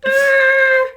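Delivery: very high, loud drawn-out noise; hammed-up high-pitched stuck sound; mock squeal. Imitated noise of a reaction.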